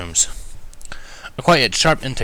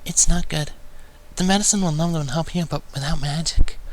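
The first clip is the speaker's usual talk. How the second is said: soft assessment; 7 semitones higher than usual. A narrator's reading. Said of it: "Fluttershy assessed softly".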